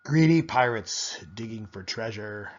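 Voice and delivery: ordinary delivery, nothing marked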